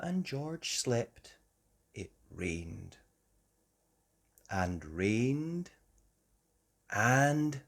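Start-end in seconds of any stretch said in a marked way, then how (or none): none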